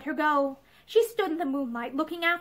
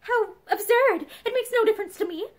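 forced lightness; 7 semitones higher than usual. A narrator's reading. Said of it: "Pansy tried to laugh".

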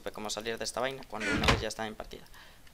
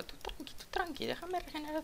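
squeaky, much higher soft plea; high mock plea; 8 dB quieter than usual. Real, not acted. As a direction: beg in a thin high voice. Asks enemy to let him heal. (súplica aguda y burlona)

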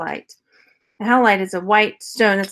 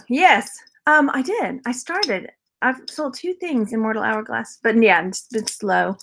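ordinary talk

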